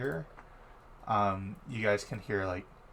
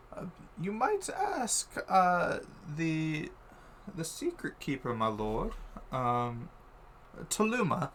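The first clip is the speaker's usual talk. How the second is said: lightly voiced hesitant retainer, higher pitch; hint of a deferential adviser; Menthor; 6 semitones higher, slower than usual. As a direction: answer haltingly, pitched up and drawn out. Game master voices Menthor answering.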